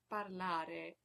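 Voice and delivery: parlare with a flipped Italian r; rolled R. Shown as a demonstration. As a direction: say parlare slowly, tapping each r. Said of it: "always pronounced rolled or flipped".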